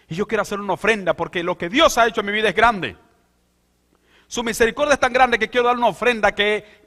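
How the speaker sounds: high, loud fervent preaching; full-force preaching. Acted. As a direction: preach it out to the whole congregation. Voices David's joyful vow.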